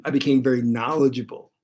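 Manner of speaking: ordinary talk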